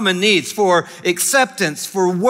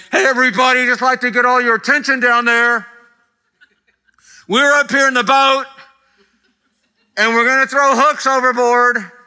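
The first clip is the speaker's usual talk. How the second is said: higher and a bit louder, calling out cheerfully over the water; mock bullhorn announcement; 5 semitones higher, 3 dB louder than usual. Preacher playing a fisherman calling out to the fish, hammed-up loud. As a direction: hail the whole lake like a proud announcer. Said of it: "announce to all the fish as loud as you can".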